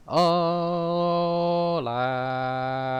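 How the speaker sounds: surprised outburst. Real, not acted. Game freezes mid-drive, loud surprised cry. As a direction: yelp at the frozen screen.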